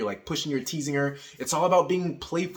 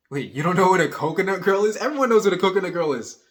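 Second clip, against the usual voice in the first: mock disbelief; 5 semitones higher, 5 dB louder than usual. Acting out mock disbelief to girl.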